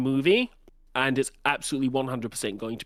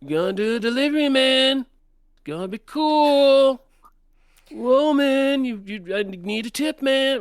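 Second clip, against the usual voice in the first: high laid-back surfer dude; 11 semitones higher, 5 dB louder than usual. Retold or imitated. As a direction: stretch it out like a chill surfer turtle. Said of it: "that's the voice we're bringing".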